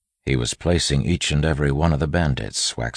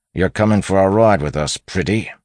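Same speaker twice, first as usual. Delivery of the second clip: higher, louder bandit voice; menacing bandit; 6 semitones higher, 7 dB louder than usual. A narrator's reading. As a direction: claim a captive with loud menace. Bandit taking a woman hostage.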